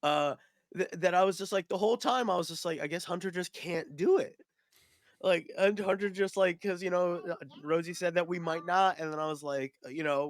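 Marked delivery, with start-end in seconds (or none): 0.0-7.1 much higher, animated retelling; giddy storytelling rush; excited. Real, not acted. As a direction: gush about how it went. Excited retelling of joining game.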